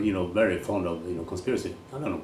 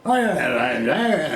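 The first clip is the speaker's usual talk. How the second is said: mock Scottish conductor, higher and louder; Scottish accent; 7 semitones higher, 6 dB louder, slower than usual. Scottish conductor answering a tourist, in a mock Scottish accent. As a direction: rattle off yeahs in a put-on Scottish accent. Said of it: "speaks Scottish".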